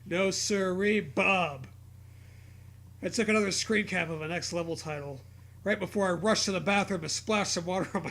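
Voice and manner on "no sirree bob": ordinary talk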